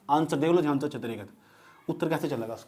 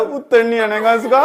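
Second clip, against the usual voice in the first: loud, higher-pitched imitation of a tearful complaint; mock crying student; 4 semitones higher, 5 dB louder than usual. Teacher retells student crying over an answer, mock wailing. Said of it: "इस बात पर रो रहा है".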